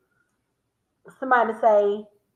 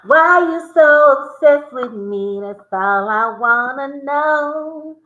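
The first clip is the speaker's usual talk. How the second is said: louder, slow emphatic; loud slow sing-song; 4 semitones higher, 7 dB louder, slower than usual. Shown as a demonstration. Giving the song line to viewers, loud and slow. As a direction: belt it out slowly. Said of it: "saying these words country".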